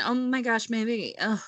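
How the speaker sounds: usual speaking voice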